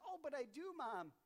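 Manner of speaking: put-on kid voice. Johnny then mom voiced.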